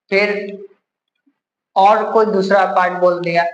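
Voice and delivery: normal talking voice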